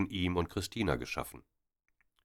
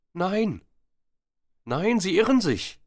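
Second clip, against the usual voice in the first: much higher, loud, panicked protest; frightened denial; 6 dB louder than usual. A narrator's reading. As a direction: push him away with your voice. Frightened woman denies accusation. (viel höher, laut und panisch abwehrend)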